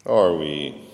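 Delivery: usual speaking voice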